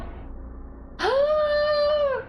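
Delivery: high, hammed-up gasp of horror; theatrical dismayed gasp; mock gasp. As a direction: fake a shocked gasp. Shocked at saying name wrong.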